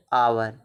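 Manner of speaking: आवर said alone, low, opening on the vowel; silent H. Shown as a demonstration. Teacher showing students that H is silent in hour, vowel-first आवर. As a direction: say आवर with no H sound at the start. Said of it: "एच का उच्चारण तो नहीं हो रहा".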